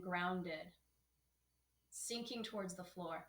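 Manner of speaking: slow, soft yoga teacher guidance with long pauses; calm, measured breathing-practice voice; guided meditation. Acted. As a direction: lead them gently into the breath, unhurried.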